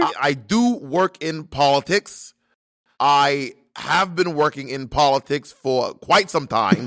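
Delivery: measured, accented politician impression; Bernie Sanders. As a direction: spell it out slowly like a veteran senator. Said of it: "I'm gonna do a voice".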